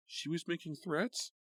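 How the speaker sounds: high, slow fearful question; sick with worry; alarmed. A narrator's reading. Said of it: "Karen felt sick".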